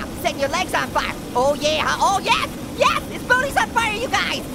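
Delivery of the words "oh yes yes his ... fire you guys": high, exultant cheering; gleeful triumph. Real, not acted. Player tells viewers Shrek is burning, gleeful triumph. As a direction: crow in a raised pitch. Celebrates setting Shrek on fire.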